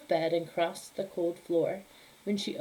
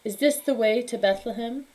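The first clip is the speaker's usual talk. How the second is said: lightly regal, louder question; royal voice; 3 semitones higher, 6 dB louder than usual. Acted. King asking Befana the way to Bethlehem, lightly royal. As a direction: ask with kingly courtesy. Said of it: "in a royal voice".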